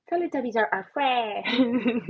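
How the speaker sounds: slow, far-off kiddie character voice; sing-song Teletubby imitation; playful toddler TV voice; Teletubbies voice.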